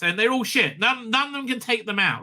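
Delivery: ordinary talk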